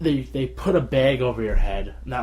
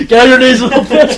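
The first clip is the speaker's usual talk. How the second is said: hammed-up shout, much higher and louder; mock tormentor; 11 semitones higher, 16 dB louder than usual. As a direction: yell it at your co-host for laughs. Repeats the guard's cruel line.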